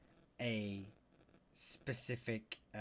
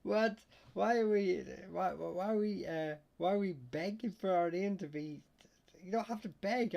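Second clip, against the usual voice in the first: loud, high-pitched incredulous question; amused disbelief; 8 semitones higher, 6 dB louder than usual. Real, not acted. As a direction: stumble over it, baffled and tickled. Amused disbelief at viewer's begging.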